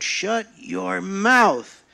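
loud, very slow, drawn-out order; shouted command. Acted. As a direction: bellow it while rushing at him. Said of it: "he shouted".

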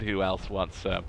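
low-key who else wants some; quiet taunt. Real, not acted. Gamer taunting enemies, quiet.

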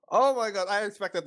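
high, loud oh my god; shocked. Real, not acted. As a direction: burst of real shock. Freaked out again.

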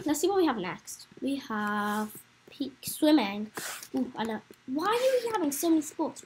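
ordinary delivery

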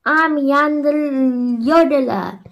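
high, loud, slow jolly mountain voice; cheerful yodeler. A narrator's reading. Yodeler greeting the mayor, high and jolly. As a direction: announce yourself like a merry yodeler. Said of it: "he said cheerfully".

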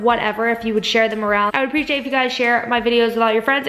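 normal talking voice

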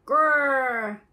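loud growling big dog. Acted. Grandma reading, dog chases kitten, loud growling voice. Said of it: "said a big dog".

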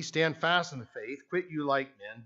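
usual speaking voice

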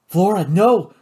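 ordinary delivery

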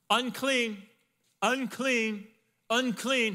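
loud, high, drawn-out cry of unclean; leper's call. Retold or imitated. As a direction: call out a warning so everyone scatters. Leper must announce himself.

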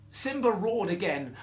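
plain reading voice

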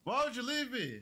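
high-pitched, wounded complaint; indignant, high-voiced pleading; upset reproach. Real, not acted. Scolds the dog for leaving him.

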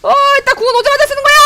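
high, loud and fast; hyped call mid-fight; excited shout. Real, not acted. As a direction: blurt it out in a rush. Ulting in excitement.

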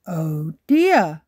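dad sighs at the problem; Daddy Pig sighing. A narrator's reading. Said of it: "says Daddy Pig".

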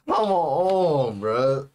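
normal talking voice

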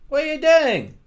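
high, loud startled yell; sudden loud high outburst. Real, not acted. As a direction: shout at the mob that wrecked it. Yelling at a game mob.

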